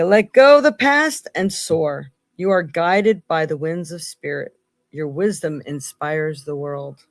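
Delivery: steady oracle card read. Acted. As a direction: read it like a message from spirit. Rereads card text.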